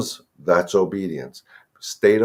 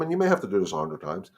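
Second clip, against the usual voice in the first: ordinary delivery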